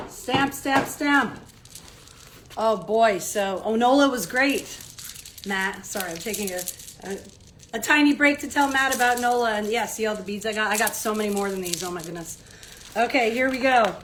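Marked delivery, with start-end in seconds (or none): none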